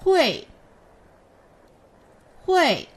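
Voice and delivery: teacher models fourth tone Hui. Shown as a demonstration. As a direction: drop Hui hard from the top. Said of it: "Read after me".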